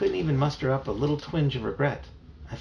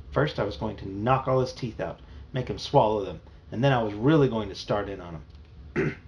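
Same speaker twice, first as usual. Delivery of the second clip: ordinary delivery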